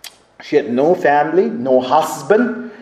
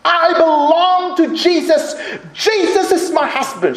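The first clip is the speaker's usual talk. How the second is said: screamed defiance; 10 semitones higher than usual. Retold or imitated. Frightened missionary facing armed intruders, mock screaming. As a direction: imitate a woman screaming off her attackers. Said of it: "she screamed at the man".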